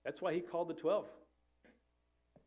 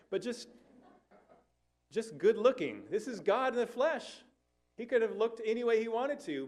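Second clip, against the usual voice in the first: much higher and louder, building with conviction; excited emphasis; 9 semitones higher, 5 dB louder than usual. Real, not acted. Preacher insisting to the congregation, high and loud. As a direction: push it up high like it's obvious. Preacher argues God could look good.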